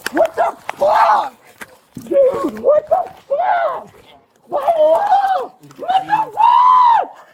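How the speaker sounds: rising high, slow furious yells; furious shouting. Real, not acted. Shouts at officers restraining him.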